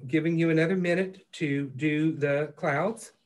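flat, mechanical robotic delivery. Real, not acted. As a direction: talk like a robot announcing the time. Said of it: "I was talking in robot voice there".

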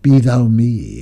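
ordinary delivery, nothing marked

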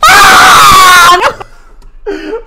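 loud, high laughing protest; laughing shout. Real, not acted.

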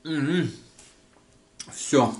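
talking between bites of food; muffled by chewing bread; mouth full, chewing. Real, not acted. Seen 0: Sips soup before, bites bread at 2.1, chews while murmuring.

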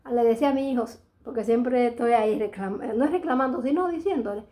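usual speaking voice

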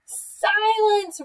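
high, loud, drawn-out roar. A narrator's reading. Bear silencing pestering Mouse, roaring. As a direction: bellow the command at full tilt. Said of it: "roared Bear".

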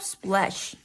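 ordinary delivery, nothing marked

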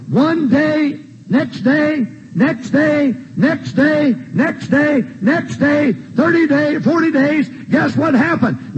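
high, evenly punched day-by-day count; preaching cadence. Real, not acted. Preacher piling up the days of quail, rhythmic high preaching.

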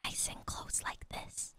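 whispering. Acted. Getting close to the mic.

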